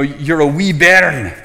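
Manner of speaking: loud, drawn-out bit of Scottish; Scottish accent. Pretend. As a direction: playfully try a Scottish accent, slow and punched. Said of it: "preach with a Scottish accent".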